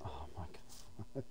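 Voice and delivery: breathy awed whisper. Real, not acted. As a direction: hush it, half to yourself. Awed at level frustration.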